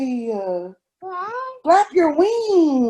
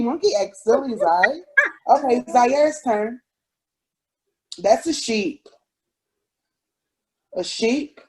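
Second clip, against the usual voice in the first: ordinary talk, nothing marked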